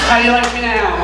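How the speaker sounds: put-on a child's smug taunt. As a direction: gloat like a defiant toddler. Toddler's defiant taunt, acted.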